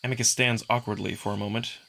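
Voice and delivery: ordinary delivery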